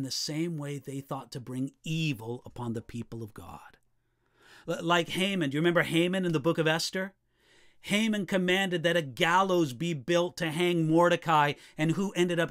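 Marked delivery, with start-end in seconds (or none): none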